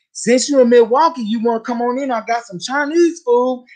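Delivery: high, coaxing mock invite; husband's invitation. Teller mimics the husband inviting her inside, high and coaxing.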